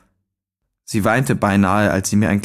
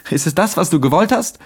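höher, bitter anklagend; wütender Sohn klagt den Vater an; 6 semitones higher than usual. A narrator's reading. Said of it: "Mir brannte das Herz vor Wut". (bitter anklagend)

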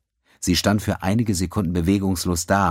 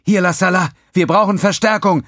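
urgent shout into a communicator; 13 semitones higher, 7 dB louder than usual. A narrator's reading. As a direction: yell for backup, high and loud. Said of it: "schrie sie in ihren Kommunikator".

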